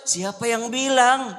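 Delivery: who said that, teasingly; teasing question. Retold or imitated. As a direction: draw it out with a wink in the voice. The Prophet teases the grandmother.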